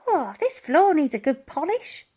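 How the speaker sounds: a prim aside; old lady fussing about the floor; fussy old lady remark. A narrator's reading. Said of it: "she remarked".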